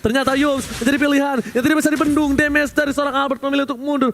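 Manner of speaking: high, hyped live play-by-play; fighting game announcer. Real, not acted. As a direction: call the fight like an arena announcer.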